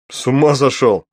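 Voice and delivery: loud, shocked outburst; stunned exclamation. A narrator's reading. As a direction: blurt it out, astonished. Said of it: "обалдел, уставился я на него".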